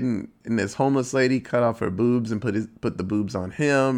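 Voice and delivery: ordinary delivery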